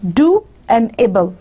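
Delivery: "do", then "able", said as two separate pieces; split into syllables. Shown as a demonstration. Teacher showing the two breaths of air in doable, syllable by syllable. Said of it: "pronounced in two breaths of air".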